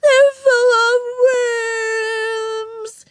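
high, very slow tearful wail; drawn-out, loud crying voice; sobbing. A narrator's reading. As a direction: bawl it out like a small child. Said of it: "she sobbed".

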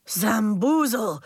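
slow, drawn-out astonishment; wowed, drawn-out character voice; amazed exclamation. A narrator's reading. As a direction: stretch out the silly exclamation. Andres exclaims at transformation.

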